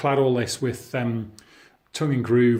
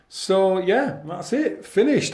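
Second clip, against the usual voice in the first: excited; 6 semitones higher, slower than usual. Real, not acted. Proud reveal of finished room.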